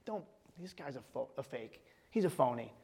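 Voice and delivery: hiss-free hush, like a voice planting doubt; hushed whisper. Retold or imitated. Pastor voicing the enemy whispering doubt, hushed. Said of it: "the enemy would whisper in their ears".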